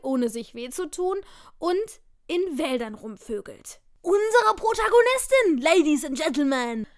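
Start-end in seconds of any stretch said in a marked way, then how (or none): 4.0-6.9 loud, high showman announcement; announcer voice. Pretend. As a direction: present her like a gameshow host. Mock announcer presenting the heroine.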